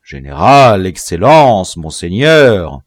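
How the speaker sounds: loud, mocking list of titles; exasperated, drawn-out titles; impatient. A narrator's reading. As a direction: throw each title back with irritation. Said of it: "reprit le chef d'un air impatienté".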